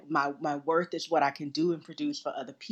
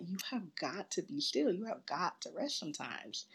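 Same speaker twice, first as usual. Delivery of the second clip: much softer, retold gentle insistence; earnest urging; 12 dB quieter than usual. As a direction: drop the volume and press the advice home. Nurse earnestly urging her to rest.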